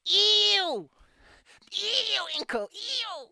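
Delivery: high squealing ew of fake disgust; mock disgust. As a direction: recoil in playful grossed-out squeals. Mock disgust at friend's habit.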